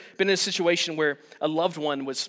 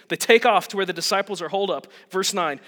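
ordinary delivery

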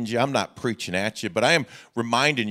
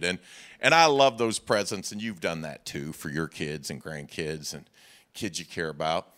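normal talking voice

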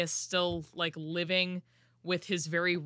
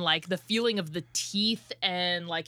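normal talking voice

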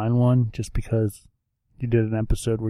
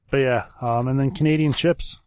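usual speaking voice

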